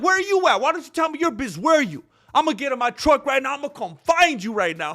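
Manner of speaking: high, loud aggressive challenge; angry yell. Retold or imitated. Angry customer yelling at the telemarketer, high loud challenge. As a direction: shout him down like a picked fight. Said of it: "He's like".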